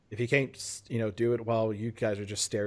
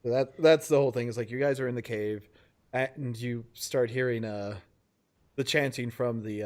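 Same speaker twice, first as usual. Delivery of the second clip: ordinary delivery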